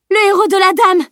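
high-pitched, urgent yell. A narrator's reading. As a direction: scream it across the battlefield. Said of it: "hurla Camille". (hurlement aigu)

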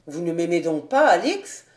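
lover's strong, low-pitched appeal; pleading captain. A narrator's reading.

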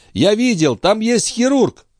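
very high, slowing urgent cry; urgent pleading. A narrator's reading. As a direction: point out help in a high, desperate voice. Old man desperately seeking help. (взволнованно, высоким голосом)

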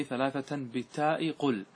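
slow chanted quote of the verse. Shown as a demonstration. Teacher quoting the verse line slowly to his students. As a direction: draw out the verse words.

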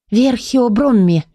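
slow, drawn-out magic words; spell incantation. A narrator's reading. Keeper casts levitation on the books, incanting. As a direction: intone it as a spell. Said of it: "произнесла заклинание левитации".

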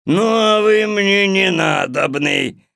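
loud, slow grumpy bellow; gruff voice. Acted. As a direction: grumble loudly like an old ogre. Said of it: "грубым голосом ответил Водяной".

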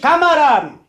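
loud, high call to the comrades; rousing cry to the assembly; rallying shout. Acted. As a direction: bellow it over the room. Rallying the crowd, calling out.

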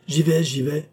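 high, hasty compliance; giving in hurriedly. A narrator's reading. Servant gives in, hurries off.

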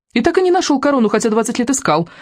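high, needling taunt; snide jibe. A narrator's reading. Kamikadze mocks Molnienos's failed search, snide. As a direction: needle him snidely, pitched well up. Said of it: "съехидничала она".

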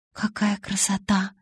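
slow, hushed wonder; awed whisper. A narrator's reading. Bella looks at the carved wolf, awed whisper. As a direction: whisper it slowly in admiration. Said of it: "прошептала я".

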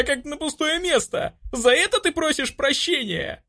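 high-pitched, indignant outburst; shrill hurt tirade; building to shouting. A narrator's reading. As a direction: hurl anguished questions, very high voice. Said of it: "Под конец речи я уже кричала".

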